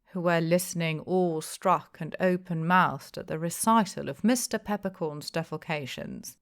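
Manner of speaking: ordinary delivery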